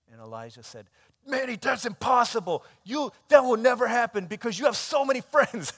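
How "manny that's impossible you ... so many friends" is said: put-on high, loud child's voice scolding his sibling; scolding little boy. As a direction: play the six-year-old, scolding in disbelief. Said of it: "He started scolding her".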